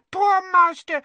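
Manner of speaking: the lament breaks out loud; wailing elf. A narrator's reading. Elf weeping for her master.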